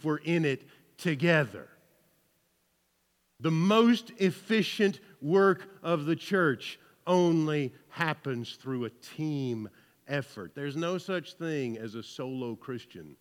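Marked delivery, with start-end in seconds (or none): none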